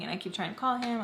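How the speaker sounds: normal talking voice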